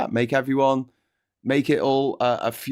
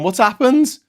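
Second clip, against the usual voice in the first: loud, high panicked wail; hammed-up morning-after panic; panicked waking; 6 semitones higher, 11 dB louder, much slower than usual. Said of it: "It's like we've all married a gold digger".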